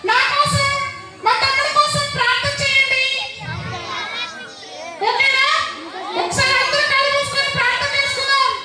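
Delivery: put-on a bright little girl calling the kids to prayer; Pinky voice. Pinky addresses children, leads prayer. (Pinky గొంతులో, చాలా ఎత్తైన స్వరంతో పిల్లలతో)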